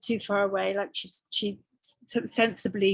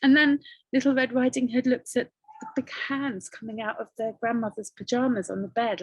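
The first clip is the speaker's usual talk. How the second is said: ordinary delivery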